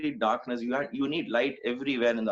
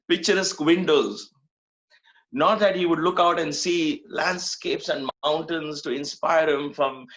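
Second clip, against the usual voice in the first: normal talking voice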